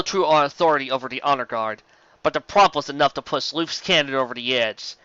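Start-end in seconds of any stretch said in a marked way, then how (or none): none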